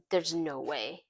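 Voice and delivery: low, hushed, stunned reaction; stunned disbelief. Retold or imitated. Jay hearing his own old song, stunned hushed disbelief. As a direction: drop it low and quiet, as if frozen. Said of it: "he's kinda frozen".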